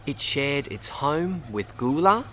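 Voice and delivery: ordinary delivery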